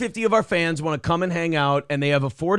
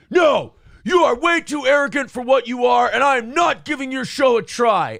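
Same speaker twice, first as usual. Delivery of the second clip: loud, higher shouted retort of an offended stranger; angry retort; 8 semitones higher, 6 dB louder than usual. Retold or imitated. Offended Twitter stranger snapping at the host, loud angry shout. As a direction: bark it back like a furious, insulted man.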